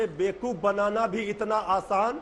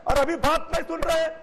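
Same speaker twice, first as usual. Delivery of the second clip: loud, raised-pitch outburst; real indignation, voice raised high; angry protest; 5 semitones higher, 7 dB louder than usual. Real, not acted. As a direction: complain heatedly over the other panellists. Heated quarrel, demands rival be removed.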